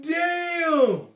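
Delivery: loud, high, drawn-out retold shock; big stretched-out cry of disbelief; shocked exclamation. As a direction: re-enact a stunned shout. Retells shocked reaction to the shove.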